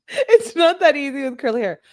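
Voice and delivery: high-pitched, laughing through the words. Real, not acted. Reader joking about curly hair, laughing.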